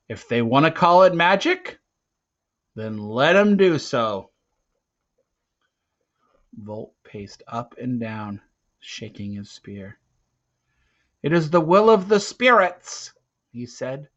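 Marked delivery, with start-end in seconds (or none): 0.1-4.3 louder, a touch higher, old man's advice lightly voiced; Graybeard's voice. Teo hears Graybeard's remembered words, lightly voiced, louder. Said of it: "he remembered Graybeard's voice". 11.2-13.1 loud and high, a ringing proclamation; proclaiming leader. Acted. Volt declares the spirits' will to the hunters, loud and ringing.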